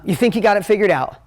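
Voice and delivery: ordinary talk, nothing marked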